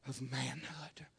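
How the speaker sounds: slow, whispered "of manhood"; a slow hushed close. Acted. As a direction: let the phrase fall into a whisper. Hushed end of phrase.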